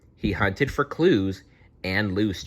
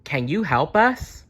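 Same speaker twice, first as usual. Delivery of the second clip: higher pleading waitress voice; a raised, hopeful plea; 7 semitones higher than usual. A narrator's reading. Waitress asking the detectives for help, in a higher voice. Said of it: "said a waitress".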